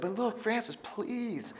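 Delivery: high pleading little king; king Louis begging Francis to allow his move. Acted. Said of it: "Lonely King Louis begged and said".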